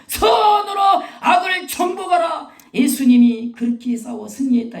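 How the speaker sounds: high, loud rallying proclamation; preaching shout. Real, not acted. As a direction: thunder it out, easing slightly at the end. Preacher proclaiming victory to congregation. (높고 크게 외치는 설교)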